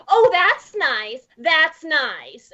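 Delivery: slow, drawn-out Midwestern mom voice. Retold or imitated. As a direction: imitate a polite mom, stretching the words. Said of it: "my mom walked in and she goes".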